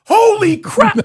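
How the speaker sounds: loud, high hammed-up alarm; startled shout. Host retelling the family seeing the dog jump. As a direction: blurt it in shock. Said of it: "we're like".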